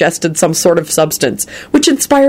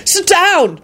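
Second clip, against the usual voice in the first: high, sharp order; demanding; 12 semitones higher than usual. A narrator's reading. Older sibling to boy with chainsaw, demanding. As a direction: order him down, pitch raised. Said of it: "Jamie demanded".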